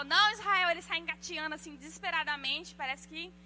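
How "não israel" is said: louder "Não, Israel", called out across the room; calling from afar. Shown as a demonstration. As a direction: call the warning to a baby out of reach. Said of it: "Quando eu tô longe, eu falo".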